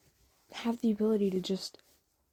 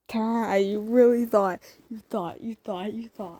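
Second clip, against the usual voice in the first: loud teasing, slowed down; gleeful gotcha; 7 dB louder than usual. Real, not acted. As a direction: tease the listener for falling for it. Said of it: "I tricked you".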